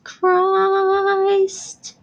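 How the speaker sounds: mock preacher. Mock pious praise, joking.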